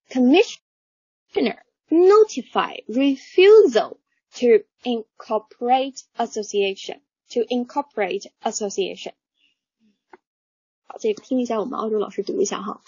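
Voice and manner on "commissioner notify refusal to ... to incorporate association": slow, careful read-aloud of the sentence. Shown as a demonstration. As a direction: read it out word by word. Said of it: "我们十条里面讲到了哪些内容". (慢速朗读)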